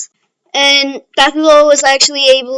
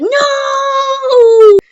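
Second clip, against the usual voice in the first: much higher, louder cry of despair; anguished cry; 13 semitones higher, 5 dB louder than usual. Retold or imitated. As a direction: cry out as if losing everything. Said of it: "And Deku's like".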